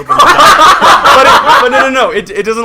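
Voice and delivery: high, loud, laughing stammer; helpless laughter. Real, not acted. Correcting own silly plot idea, laughing hard. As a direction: try to protest while cracking up.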